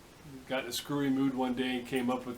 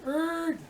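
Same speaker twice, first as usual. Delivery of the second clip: high-pitched imitated brake screech; drawn-out high "errr" of a sudden stop; screeching-halt noise. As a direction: squeal it like tires stopping dead. Imitates camel stopping suddenly.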